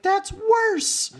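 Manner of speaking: very high, loud squeal; squealed deadpan. Retold or imitated. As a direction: squeal the retort. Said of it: "Wendell's like".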